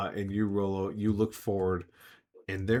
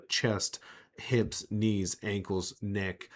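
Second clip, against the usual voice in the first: ordinary talk, nothing marked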